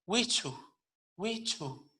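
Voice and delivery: "wichu" with breath after the CH; breathy ch-h inside "wichu"; aspirated CH. Shown as a demonstration. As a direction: let the CH of "wichu" gush air. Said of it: "C H aspirada".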